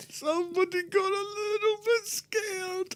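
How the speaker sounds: mock baby talk. Teasing co-host about being scared, high soft baby-talk taunt. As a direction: coo it like talking to a baby.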